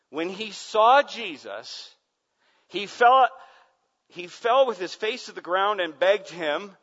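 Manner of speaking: loud, high, impassioned preacher voice; full-force pulpit cry; fiery preaching. Acted. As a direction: preach it to the back row. Preaching climax.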